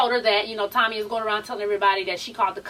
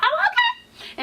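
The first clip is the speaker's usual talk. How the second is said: very high-pitched "oh, okay"; surprised squeal; slower than usual. Retold or imitated. Vlogger quoting her own reaction to the show, high squeal.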